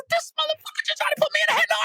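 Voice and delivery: fast, high, laughing through the words; laughing disbelief. Retold or imitated. Retelling opponent's surprise move, fast laughing disbelief. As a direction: rush it out while cracking up.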